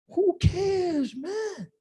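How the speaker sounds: high, incredulous who cares; fed up with celebrity gossip chatter; exasperated. Real, not acted. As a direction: throw up your hands in disbelief.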